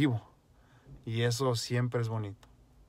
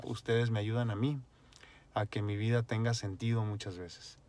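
usual speaking voice

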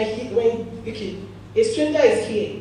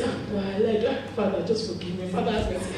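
hushed mock plea for mercy; quiet confession; 3 semitones lower, 8 dB quieter, faster than usual. Preacher acting a liar begging God softly, quiet plea. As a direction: murmur an embarrassed confession to God.

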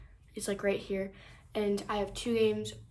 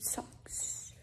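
whispering; 11 dB quieter than usual. Real, not acted. Hushed confession about stolen socks.

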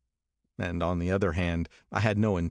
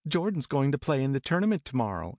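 higher, lilting Daisy voice; Daisy explaining Jordan's tournament, higher; 7 semitones higher than usual. A narrator's reading. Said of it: "explained Daisy".

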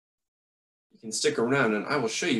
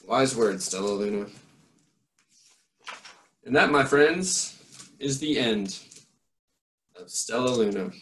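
put-on lively read-aloud voice for children; story narration; 2 semitones higher, 3 dB louder than usual. A narrator's reading. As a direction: read it like a bedtime picture book. Reads a picture book aloud.

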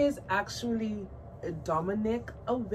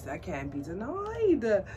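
ordinary delivery

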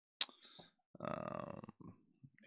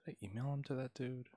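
whispering; 5 semitones higher, 10 dB quieter than usual. Real, not acted. Muttering to himself while searching.

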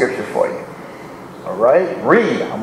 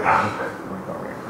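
softer, whispered mock eagerness; 5 semitones lower, 10 dB quieter than usual. Mocking eager readers in the pews, whispered. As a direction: breathe it like a sheepish churchgoer. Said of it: "Some of y'all like".

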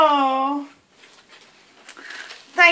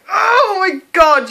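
high, loud, drawn-out gasping delight; loud swooping exclamation; thrilled outburst; 6 semitones higher, 8 dB louder than usual. Real, not acted. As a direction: cry it out slowly, overwhelmed by the gift. Thrilled by a gift.

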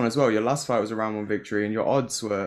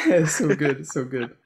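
chuckling through the words; laughing; 3 semitones higher, slower than usual. Real, not acted. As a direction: laugh while you say it. Teammates distracting, both laughing.

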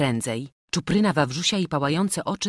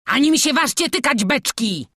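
loud, high furious howl; enraged robber wailing; howling from underground; 11 semitones higher, 8 dB louder than usual. A narrator's reading. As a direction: bellow a desperate threat. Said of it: "wyło z podziemi".

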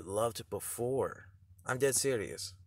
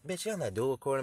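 ordinary delivery

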